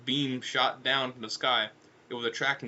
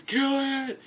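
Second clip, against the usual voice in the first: attack cry; 12 semitones higher, 3 dB louder than usual. Acted. Alien's greeting cut by attack cry.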